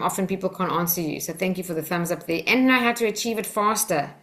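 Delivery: ordinary talk, nothing marked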